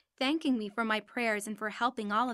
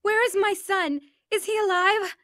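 much higher and louder, frantic; panicked crying out; 9 semitones higher, 8 dB louder than usual. Retold or imitated. Injured mother crying out at the crash, high panicked cries. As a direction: cry out in terror, blind and lost.

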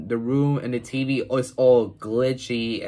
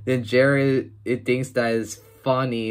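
usual speaking voice

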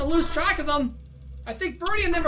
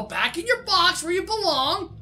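normal talking voice

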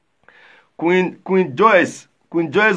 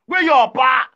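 shouted question; 5 semitones higher, slower than usual. Real, not acted. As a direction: yell it so the house hears. Calling out for Benjamin's father.

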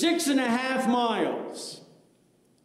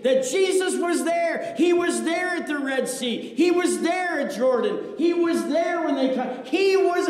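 preaching at full force; 5 semitones higher than usual. Acted. Preaching climax.

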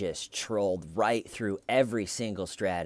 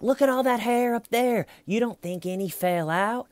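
hammering interrogator; 13 semitones higher, 4 dB louder than usual. Retold or imitated. Red Shirt scratching his own hair at the suspect, loud and high. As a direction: drag out each Look, Steven to rattle him. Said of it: "He's like".

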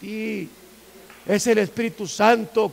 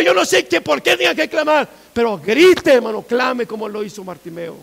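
loud, raised, urgent sermon voice; fiery pulpit exhortation; full-force preaching; 7 semitones higher, 12 dB louder than usual. Real, not acted.

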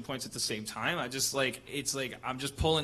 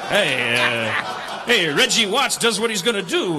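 loud, high, laughing Bogart imitation; a Bogart that drifts New York; Humphrey Bogart impression; 6 semitones higher, 8 dB louder than usual. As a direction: ham up a Bogart tough guy. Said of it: "your impression of Humphrey Bogart".

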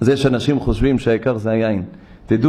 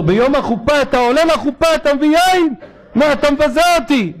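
much higher, louder offended outrage; indignant rebuke; 12 semitones higher, 3 dB louder than usual. Retold or imitated. The rabbi insulted by the groom's gift, high and indignant.

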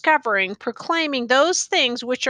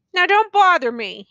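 tense, louder warning with a threat in it; desperate menace; 7 semitones higher, 5 dB louder than usual. A narrator's reading. Youth warns tattered man off, desperate and menacing. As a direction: push him away, desperate and menacing. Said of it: "with desperate menace".

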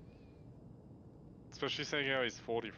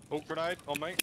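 higher, startled warning; alarmed; 7 semitones higher, 4 dB louder than usual. Real, not acted. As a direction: blurt it as the grenade lands. Grenade lands beside him.

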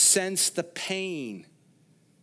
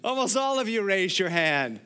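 ordinary talk

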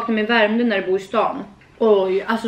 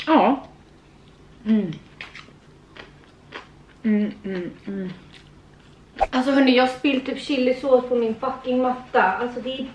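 eating and murmuring; chewing food; 3 dB quieter than usual. Real, not acted. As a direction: mouth full while humming. Seen 0: Eats noodles at 0.9, chews open-mouthed while humming throughout.

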